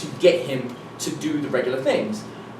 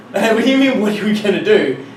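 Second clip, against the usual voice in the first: loud, higher-pitched disbelief; incredulous retort; 4 semitones higher, 5 dB louder than usual. Retold or imitated. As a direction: throw the question back, exasperated. Retold incredulous retort to instructor.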